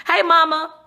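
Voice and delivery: high, loud greeting; loud shout-out; calling out. Real, not acted. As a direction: call brightly to your mother.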